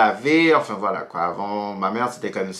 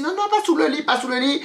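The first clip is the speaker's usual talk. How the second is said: panique; 14 semitones higher, faster than usual. Retold or imitated. Panique, cache le garçon.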